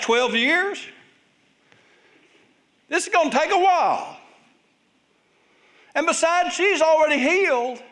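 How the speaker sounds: an anxious father losing patience at the delay; exasperated Jairus. Acted. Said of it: "I can only imagine how Jairus is feeling".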